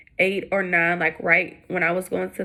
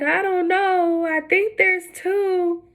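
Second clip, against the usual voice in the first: much higher, slow, hesitant nurse voice; nurse revealing twins; 11 semitones higher, much slower than usual. Retold or imitated. Nurse telling the mother there are two babies, high and slow. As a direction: draw it out like an unsure nurse.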